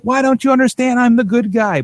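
high-pitched mock indignation; exasperated self-righteous villain. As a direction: plead your case as the misunderstood good guy. Voices villain insisting he is good.

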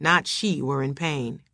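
usual speaking voice

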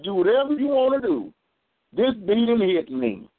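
high, defiant snap at the officer; defiant retort. Retold or imitated. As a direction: throw it back at her in defiance. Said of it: "I said".

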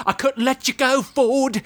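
high mock assassin voice; Robert Englund. Returned assassin to Ford Fairlane, high imitated villain voice. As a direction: imitate a movie villain, pitched well up. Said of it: "And the guy's like".

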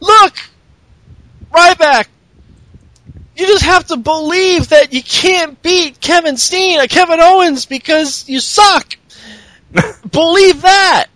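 high, preachy hammed-up believer voice; a high pitched mock sermon to Ryback; parodying Bo Dallas preaching at Ryback. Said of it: "and he's all".

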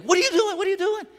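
high, loud alarmed yell; retold shout. Airport guards yelling at the runaway passenger, loud and high. Security staff yelling at him.

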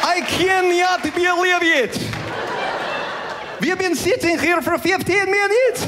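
high-pitched, hammed-up Russian accent; mock Russian, Y sounds between the letters. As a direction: complain in a thick comic Russian accent. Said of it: "the Russian accent".

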